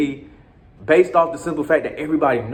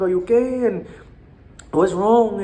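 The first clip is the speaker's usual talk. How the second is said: put-on higher concerned girlfriend voice; girlfriend's voice; 7 semitones higher than usual. Colombian girlfriend asking after rough night, higher voice. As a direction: ask it up high like a worried girlfriend. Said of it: "But she was like".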